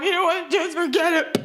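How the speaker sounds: put-on high-pitched huffy girlfriend; hammed-up offended huff; mock upset. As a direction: act wounded and dismissive, pitched high. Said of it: "Your argument will magically be transformed from".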